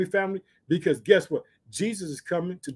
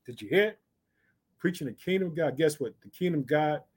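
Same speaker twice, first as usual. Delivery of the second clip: ordinary talk, nothing marked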